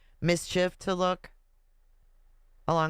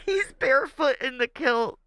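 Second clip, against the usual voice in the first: high, louder, gleeful exclaim; delighted squeal; 8 semitones higher, 4 dB louder than usual. Real, not acted. Squealing at the picture of him in a kilt.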